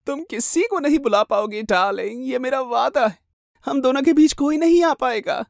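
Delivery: high, taunting madwoman; crazed menacing wife. Acted. Jealous wife vowing nobody will save her rival, high and menacing. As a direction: promise doom possessively, up high.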